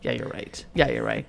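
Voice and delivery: low, soft mock concession; retold grudging concession. Retelling son admitting mom is right, quietly conceding. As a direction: imitate giving in, voice dropped low and soft.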